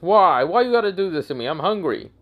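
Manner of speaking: high, acted whine; whining complaint. Hungry bear protests to Naruto, whining complaint.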